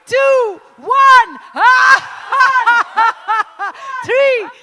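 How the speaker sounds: high, loud countdown with yells; hyped launch countdown; shouted countdown. Acted. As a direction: build the room to the laugh. Countdown to group laugh.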